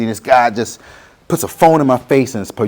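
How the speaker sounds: usual speaking voice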